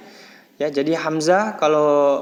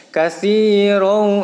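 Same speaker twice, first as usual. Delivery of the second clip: "kasirau" slow and higher, with the middle "si" held; drawn-out recitation; 5 semitones higher, 6 dB louder, much slower than usual. Shown as a demonstration. Teacher models ya sukun lengthening, drawn-out recitation. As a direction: chant "kasirau", stretching si. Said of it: "dibaca panjang satu ayunan".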